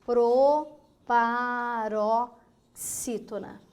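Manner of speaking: Proparoxítona drawn out very slowly, each syllable separated. Shown as a demonstration. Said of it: "a terceira sílaba de trás para frente mais forte". (Proparoxítona bem devagar, separando as sílabas)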